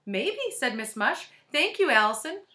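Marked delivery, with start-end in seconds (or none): 0.1-0.5 sweet high lady voice. A narrator's reading. Teacher through door. 1.5-2.4 high and loud cheery; sweet high lady voice. A narrator's reading. Teacher through door.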